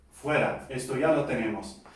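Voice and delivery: ordinary talk, nothing marked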